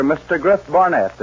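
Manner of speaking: ordinary talk, nothing marked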